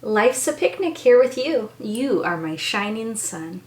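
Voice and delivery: gentle storybook reading; reading to children. Acted. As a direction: bedtime picture-book voice. Reads picture book aloud.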